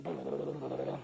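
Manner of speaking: low, soft rapid babble; imitated pounding heart. Shaken hunter showing his heart racing, low burble. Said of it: "my heart's going".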